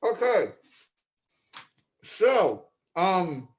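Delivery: talking round a marshmallow; muffled words through a stuffed mouth; mouth full of marshmallow. Real, not acted. Host attempting the next bit with a marshmallow in his mouth, mouth full. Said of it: "says the next bit with another marshmallow".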